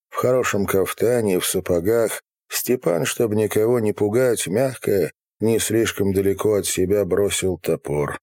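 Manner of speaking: usual speaking voice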